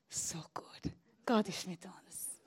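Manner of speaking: soft unvoiced whisper. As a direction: breathe it like a secret. [name]'s whispered confidence. (leise geflüstert)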